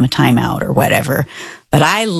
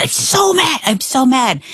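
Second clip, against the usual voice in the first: imitating mad kid; 8 semitones higher than usual. Mom imitating her furious eight-year-old, high and mad. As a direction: act out an upset little brother's outburst. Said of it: "the younger brother is so mad".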